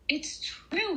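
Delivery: high, startled exclamation; a doctor gasping in disbelief; the doctor gasps as the bee flies out; gasped in amazement. Acted. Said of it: "he gasped".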